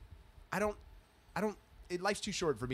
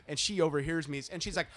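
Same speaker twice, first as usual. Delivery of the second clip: ordinary delivery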